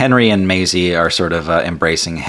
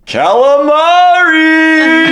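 very high, drawn-out yell; Genie's battle cry; 7 dB louder, much slower than usual. Acted. Genie bursting from the lamp at the octopus, long high yell. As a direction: belt it out loud and stretch the ending way up high. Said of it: "he just says".

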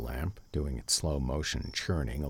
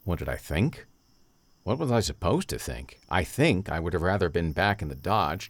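ordinary delivery, nothing marked

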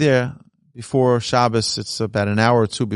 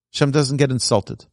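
ordinary delivery

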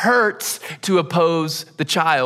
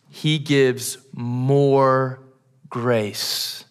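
drawn-out reveal; 3 semitones lower, much slower than usual. Real, not acted. Dramatic reveal of grace.

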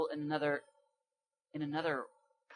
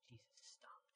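hushed, whispered awe; 31 dB quieter, slower than usual. Real, not acted. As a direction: whisper it as the line you love. Hushed dramatic repeat of favourite line.

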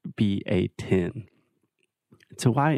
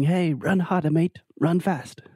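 higher-pitched mock Australian trainer; terrible Australian accent; 8 semitones higher than usual. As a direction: urge a runner on in a hammed-up Australian accent. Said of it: "sorry for the terrible Australian accent".

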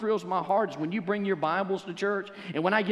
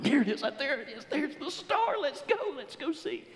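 much higher, eager chatter; excited magi; 10 semitones higher than usual. Acted. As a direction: bounce with eagerness. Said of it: "jumping around going".

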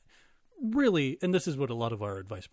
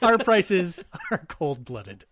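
laughing; 4 semitones higher, 10 dB louder, much slower than usual. Acted. Ad tagline delivered slowly.

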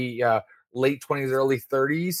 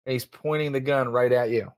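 ordinary talk

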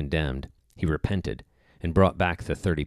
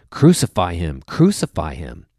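crowd crying out; 7 dB louder than usual. A narrator's reading. Chief priests and officers demand a death sentence, crying out in measured narration. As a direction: read it as a crowd's emphatic demand. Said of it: "they cried out".

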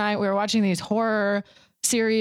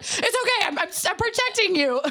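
nervous reassurance; 11 semitones higher than usual. Retold or imitated. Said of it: "he's like".

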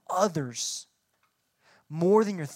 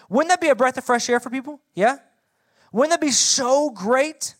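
higher, louder hopeful appeal; eager rhetorical question; 5 semitones higher, 6 dB louder than usual. Real, not acted. Preacher asking students if honesty wouldn't be great, higher and louder.

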